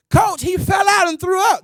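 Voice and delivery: high urgent call to the coach; alarmed call. Retold or imitated. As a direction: shout the news across the track. Teen players calling to coach.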